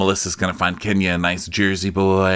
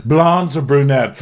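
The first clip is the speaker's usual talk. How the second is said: high, slowed mock questioning; pointed question; 6 semitones higher, 4 dB louder, slower than usual. [name] pressing John on his type, pointed mock question. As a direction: press the choice in a raised voice. Mimicked demand, first take.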